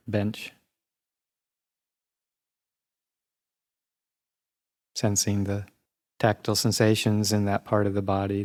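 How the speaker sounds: even, unhurried meditation voice with long pauses; guided meditation. Real, not acted. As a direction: guide listeners calmly through the body, leaving space between phrases.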